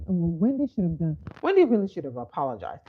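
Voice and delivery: ordinary delivery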